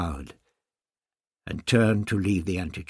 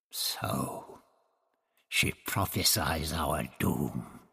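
sneering; 5 semitones lower, much slower than usual. A narrator's reading. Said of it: "the Toxel sneered".

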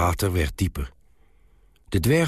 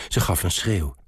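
ordinary delivery

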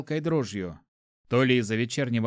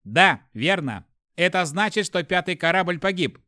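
raised, louder detached machine voice; icy ship computer; 5 semitones higher, 4 dB louder than usual. A narrator's reading. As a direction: answer like an unfeeling AI. Ship computer answers hero.

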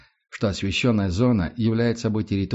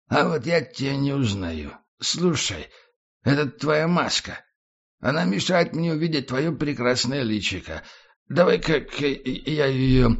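put-on drunk voice; 4 semitones higher than usual. Tramp keeps talking drunk.

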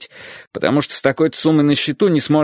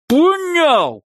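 even higher, louder scream; 4 dB louder than usual. Acted. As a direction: scream the repeat harder. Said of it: "повторил он".

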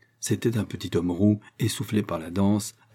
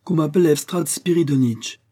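high, loud summons; exclaimed order, pitched up; crying out; 8 semitones higher, 5 dB louder than usual. A narrator's reading. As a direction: call out indignantly for help. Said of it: "s'écria Gestiakov".

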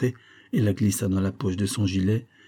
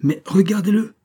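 high, loud outburst; impetuous cry; 12 semitones higher, 7 dB louder than usual. A narrator's reading. Olivia pointing at her drunken husband, impetuous cry. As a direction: burst out impetuously. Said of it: "s'écria-t-elle avec impétuosité".

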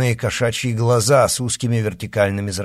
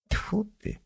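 disappointed snort; 10 semitones higher, 10 dB quieter than usual. A narrator's reading. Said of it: "разочарованно фыркнула новенькая".

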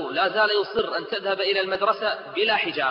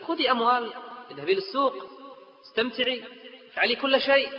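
higher, coaxing mother offering everything; mother tempting; 8 semitones higher than usual. Acted. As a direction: dangle every pleasure before her. Mother voiced tempting daughter.

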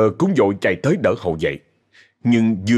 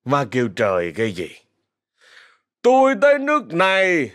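put-on aggrieved drunken complaint; bitter drunken protest; 9 semitones higher than usual. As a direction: lash back in drunken self-pity. Drunk son protests bitterly to mother.

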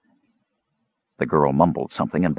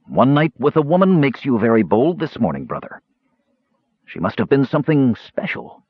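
higher-pitched, needling mage voice; bitter sarcasm; 6 semitones higher than usual. A narrator's reading. Mage taunting his brother about a woman, sarcastic. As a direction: needle him, dropping away on the aside. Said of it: "his brother's bitter sarcasm".

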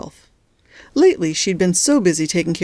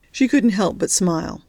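plain reading voice

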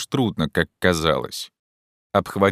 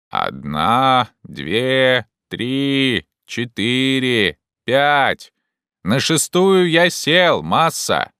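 drawn-out, higher-pitched counting; calling down a count; 6 semitones higher, 4 dB louder, much slower than usual. Acted. Jupiter counts branches for master, calling down. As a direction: count out loud so it carries.

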